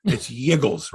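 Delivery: normal talking voice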